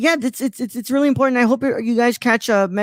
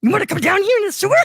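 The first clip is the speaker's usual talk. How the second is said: much higher, sing-song creepy clown; creepy clown calling down the sewer; Pennywise impression; 10 semitones higher, faster than usual. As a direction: lure him in with a creepy high clown voice. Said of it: "Pennywise luring Kermit".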